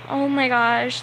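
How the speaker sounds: affronted "oh my gosh" act; mock defensive. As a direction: imitate someone getting all defensive. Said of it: "like, defensive at me".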